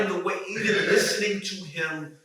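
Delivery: loud, high, laughing hype; fired up talking about the director; excited. Real, not acted. Raving to friends about Coogler, loud and excited.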